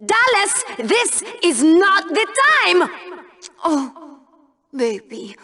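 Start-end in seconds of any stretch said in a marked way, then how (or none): 3.6-5.3 mock baby talk. Cooing at Dallas like baby.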